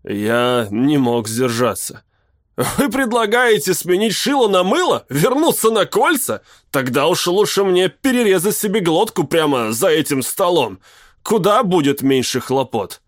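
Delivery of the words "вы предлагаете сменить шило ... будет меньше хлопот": heated outburst. A narrator's reading. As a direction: blurt it out, furious and bitter. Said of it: "Не стоит кипятиться".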